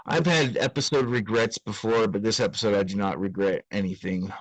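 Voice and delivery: ordinary talk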